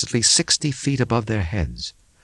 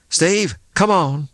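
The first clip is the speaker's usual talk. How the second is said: calling out; 9 semitones higher, 4 dB louder, slower than usual. A narrator's reading. Tracy calling from outside.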